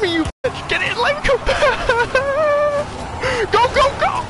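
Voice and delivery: high panicked yelling; frantic shouting. Real, not acted. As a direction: yell at him to drive. Frantic escape in car.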